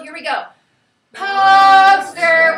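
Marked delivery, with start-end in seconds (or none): 1.1-2.5 loud, sung-out call, higher pitch, long vowels; calling to dinner. Shown as a demonstration. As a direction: holler it up the hill as if for dinner. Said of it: "Call it up on the hill".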